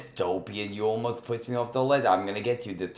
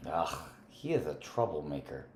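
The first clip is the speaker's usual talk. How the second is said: ordinary talk, nothing marked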